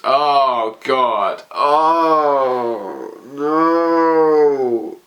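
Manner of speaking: pained cry, rising on no; reacting to a sad spoiler, crying out oh no; anguished cry. Real, not acted. Reader sees Hanji promoting Armin.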